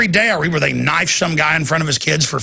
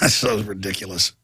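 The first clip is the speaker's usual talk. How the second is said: laughing; 7 semitones lower, 3 dB quieter than usual. Real, not acted. Laughing at the news.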